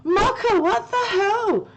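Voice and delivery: high, slow, stretched disbelief; high mock outrage. Real, not acted. Streamer shocked at Mocha's high IQ score, high stretched disbelief. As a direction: drag it out in amazed protest.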